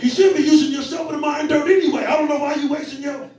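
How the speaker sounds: loud, high, laughing rant; dad loudly mocking son's complaint. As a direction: throw it back at him, half laughing. Dad throwing kid's words back.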